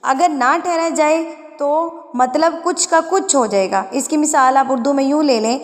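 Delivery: usual speaking voice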